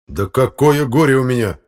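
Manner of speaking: loud, high indignant retort. Acted. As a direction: reject it with outrage. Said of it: "возмутился Фёдор Игнатьевич". (громко, возмущённо)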